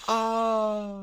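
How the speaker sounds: high, held "ah" in place of a scream; half-hearted attempt at a scream; high wail that stops short of screaming; held-back scream. Shown as a demonstration. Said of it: "like, a scream".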